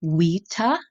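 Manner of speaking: vita said as wita. Shown as a demonstration. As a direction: say vita with a W, wee-tah. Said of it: "I'm pronouncing a V like a W".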